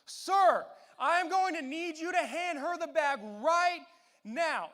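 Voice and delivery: full-throated slow shouted command; yelling at the top of his lungs. Retold or imitated. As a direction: bellow the order across the hallway. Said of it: "at the top of his lungs".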